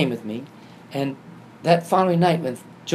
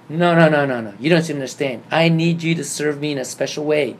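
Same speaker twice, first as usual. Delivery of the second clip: loud, emphatic correction; imitating a mother who won't take no; firm insistence; 2 semitones higher, 7 dB louder than usual. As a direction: cut in and insist forcefully. Mary insisting in brother's story.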